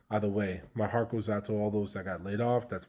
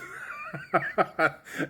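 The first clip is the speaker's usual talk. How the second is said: burst of laughter; 7 semitones higher than usual. Real, not acted. Laughing at his own news.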